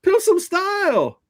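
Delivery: high, slow drawn-out command; slow high mocking hype; mock promo. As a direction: stretch it out high like a hype man.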